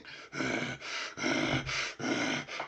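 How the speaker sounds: long, harsh angry huffing; seething breaths. Acted. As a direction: breathe hard like an enraged dwarf. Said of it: "the dwarf is just seething".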